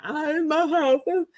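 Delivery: high, hammed-up tearful wail; mock crying, pleading up high; crying retold. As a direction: plead to God in a put-on sobbing voice. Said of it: "I was crying".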